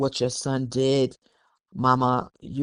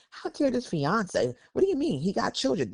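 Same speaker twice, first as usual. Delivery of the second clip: high, soft mock outrage; retold indignation; 8 semitones higher, 6 dB quieter than usual. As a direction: act out an offended reaction, pitched well up. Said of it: "we're gonna be pissed".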